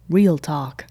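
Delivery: deep American host voice, punchy; American accent. Shown as a demonstration. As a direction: redo it like an American announcer. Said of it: "wait, do it again?".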